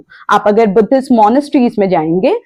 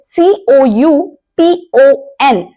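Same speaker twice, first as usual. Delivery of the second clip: letter by letter, spaced and slow; slowly spelt letters; slow spelling; 3 semitones higher, much slower than usual. Shown as a demonstration. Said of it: "spelling the word".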